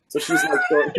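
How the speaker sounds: breaks into real laughter; laughing. Real, not acted. Retelling the cop's answer, real laughter. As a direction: laugh through the words.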